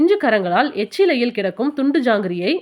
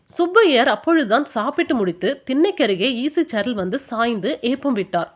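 usual speaking voice

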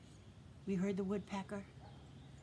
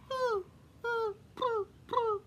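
much higher cooing boo boo like a pigeon; playful pigeon call, much higher; 14 semitones higher, slower than usual. Retold or imitated. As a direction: coo like a pigeon for the kids. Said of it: "And they say".

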